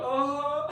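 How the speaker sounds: awkward uh. Pretend. Freezing mid invite.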